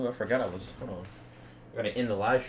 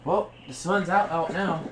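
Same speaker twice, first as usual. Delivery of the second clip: excited; 8 semitones higher, 6 dB louder than usual. Real, not acted. Sun comes out on stream.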